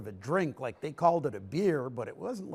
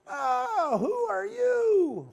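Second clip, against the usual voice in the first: much higher, slow, strange little gnome voice; old gnome voice; 4 dB louder, much slower than usual. Acted. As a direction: draw it out like a tiny ancient gnome.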